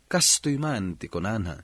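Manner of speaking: normal talking voice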